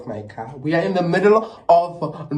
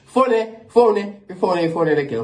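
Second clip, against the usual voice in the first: urgent insisting; 6 semitones higher, 4 dB louder, slower than usual. Retold or imitated. Urgent calling out.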